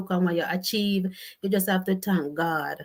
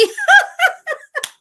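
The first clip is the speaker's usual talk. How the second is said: very high, loud laughter; cracking up at the airport story; shrieking laugh; 10 dB louder than usual. Real, not acted. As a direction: burst into a screaming laugh. Laughing at comment.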